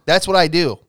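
usual speaking voice

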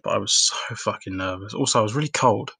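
ordinary talk, nothing marked

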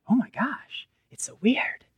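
breathy whispered amazement at your own culture; a whispered oh-my-gosh; whispered aside. Retold or imitated. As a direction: hush it like a private shocked thought. Inner reaction after coming home.

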